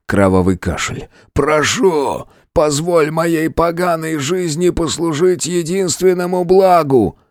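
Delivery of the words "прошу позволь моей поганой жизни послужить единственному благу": desperate plea. A narrator's reading. Dying friend pleads.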